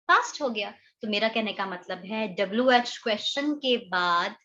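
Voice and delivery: ordinary talk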